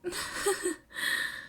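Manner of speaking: high, shaky breathy laugh; nervous little laugh, high and shaky. Acted. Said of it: "Momo laughed, high and shaky".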